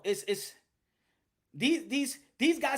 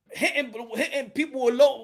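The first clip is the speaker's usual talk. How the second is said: ordinary talk, nothing marked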